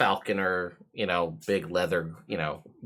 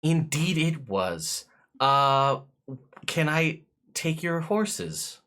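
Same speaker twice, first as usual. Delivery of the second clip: lightly voiced servant, a touch higher; careful, deferential questions; polite footman; 10 semitones higher than usual. Footman offering to take the guests' horses, politely hesitant. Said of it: "and be like".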